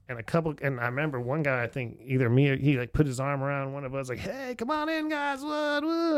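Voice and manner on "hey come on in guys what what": mock eager welcome. The older regular welcoming the young Americans back, high and eager. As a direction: gush over them, arm round a shoulder.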